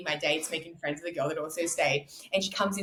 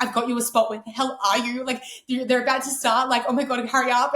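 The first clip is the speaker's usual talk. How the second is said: loud, higher, pressing her to hurry; urgent hurrying; 4 semitones higher, 6 dB louder than usual. Retold or imitated. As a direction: imitate a friend urgently calling. Friend urgently hurrying her backstage.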